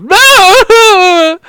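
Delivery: high, loud, drawn-out wail of no; loud cry. Acted. Game master cries out as Dorhan, loud drawn-out wail. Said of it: "lets out a cry of".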